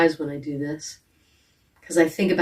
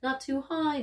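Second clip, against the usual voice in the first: "not too high" lifted higher in pitch; 6 semitones higher, 6 dB quieter than usual. Shown as a demonstration. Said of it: "an easy pitch".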